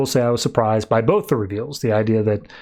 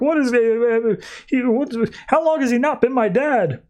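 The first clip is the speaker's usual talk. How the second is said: much higher, shocked disbelief; panicked; 10 semitones higher than usual. Retold or imitated. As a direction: squeak out the panic. Matt panics about fake dad.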